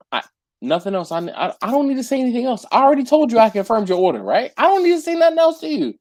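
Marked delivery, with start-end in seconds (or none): 1.1-5.9 rapid higher indignant rant; exasperated rant. Real, not acted. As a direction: rattle it off, fed up. Ranting about late texts.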